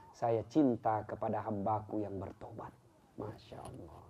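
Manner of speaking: normal talking voice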